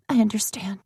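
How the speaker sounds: ashamed whisper, near tears; soft tearful whisper. Acted. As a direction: barely breathe the words, head down.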